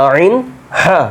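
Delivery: "আইন" throaty, "হা" breathed from mid-throat; teacher repeating the throat letters; Arabic ain and ha. Shown as a demonstration. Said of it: "খেয়াল করেন".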